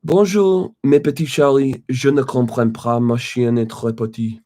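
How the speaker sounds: French read aloud in a bright-pitched, competitive accent. Shown as a demonstration. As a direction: show off your best French accent. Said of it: "who has the better accent".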